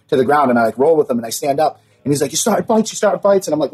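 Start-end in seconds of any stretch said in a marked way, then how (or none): 2.3-3.5 lightly mimicked cop, pitched high; high-pitched imitation of an accusing officer. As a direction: retell the cop's accusation in a higher voice. Retold cop accusing him.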